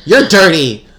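playful retort. Real, not acted. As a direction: throw the word back at her.

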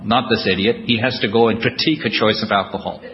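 normal talking voice

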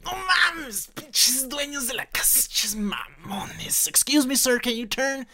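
high, hammed-up Mexico City dad ranting, then a mock polite English plea; high mock chilango dad voice; impression of his dad. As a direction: mimic dad cursing the homeowners. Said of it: "spot-on impression".

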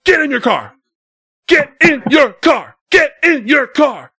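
repeated order rising from firm to a loud shout; building command. Retold or imitated. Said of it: "and he's like".

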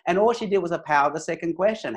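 usual speaking voice

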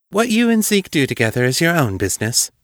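curt and fast, pitched high; said shortly. A narrator's reading. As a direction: cut him off sharply. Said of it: "Julia said shortly".

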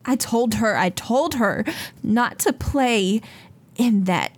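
high, pleading mother's voice, near tears; distraught mother. Acted. Frantic mother begs the Seekers to find her daughter, high and pleading. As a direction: beg like a frantic mother whose child is gone. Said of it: "And she says".

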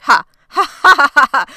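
mock scornful laugh. Shauna scoffs at Jackie's jealousy claim, loud fake laugh. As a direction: force out a scornful stage laugh.

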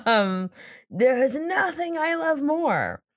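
slow, heartfelt gushing; passionate. Pretend. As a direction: gush about loving it. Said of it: "I can feel the passion in your voice".

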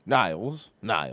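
low-pitched fake British; hammed-up low British role; mock British accent. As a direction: call to him in a deep mock-British voice. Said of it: "your accents are decent".

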